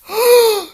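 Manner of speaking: high loud startled gasp; hammed-up gasp of shock; sharp gasp. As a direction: gasp in recognition. Character recognizes the princess.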